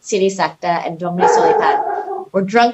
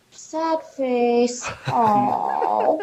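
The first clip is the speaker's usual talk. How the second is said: mock sad face; 3 semitones higher, 5 dB quieter, much slower than usual. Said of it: "got this look on their face like".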